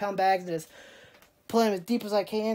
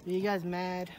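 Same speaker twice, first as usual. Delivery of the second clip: ordinary talk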